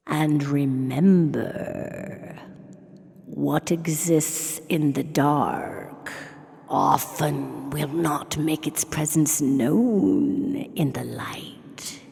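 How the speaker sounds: slow, low, creepy whispered warning; spooky whisper. Acted. Host whispers a creepy goodnight to listeners, slow and low. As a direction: hush it out like a ghost story at bedtime.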